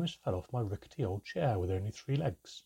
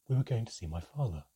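ordinary delivery

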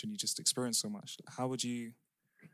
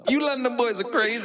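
loud banter; 12 semitones higher, 11 dB louder than usual. Real, not acted. Shouting banter at the end.

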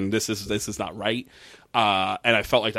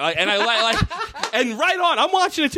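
laughing; 13 semitones higher than usual. Real, not acted. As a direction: laugh while cheering her on. Host laughing at the scene.

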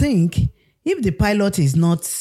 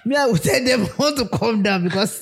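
giggling through the words; laughing; 6 semitones higher than usual. Real, not acted. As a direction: laugh while you talk. Laughing at scenario.

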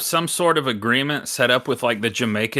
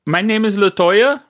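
high, loud mock Jamaican accent; 10 semitones higher, 6 dB louder than usual. Hotel manager introducing herself to a late guest, bad Jamaican accent. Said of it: "This is a horrible Jamaican accent".

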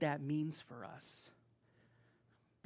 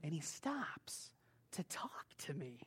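whispered aside; 3 semitones lower, 12 dB quieter, slower than usual. Real, not acted. Aside about kind old man.

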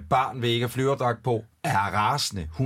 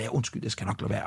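low, soft apologetic murmur; subdued, guilty father; sheepish apology; 5 semitones lower, 6 dB quieter than usual. Retold or imitated. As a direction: apologise under your breath, retold. Father's sheepish inner apology, retold.

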